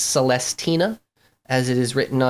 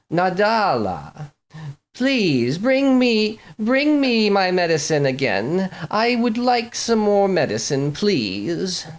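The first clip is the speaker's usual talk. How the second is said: slow, drawn-out, high moaning plea; moaning sick woman; 5 semitones higher, slower than usual. Acted. Sick prima donna calling her maid from the other room, slow high moan. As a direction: groan it out like she's bedridden. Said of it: "a moan from, uh, from a woman saying".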